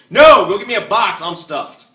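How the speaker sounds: loud high refusal; full customer. Retold or imitated. Diner turning down the waitress, loud and stuffed. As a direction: turn her down loudly, proud to be full. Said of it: "right to her face, you go".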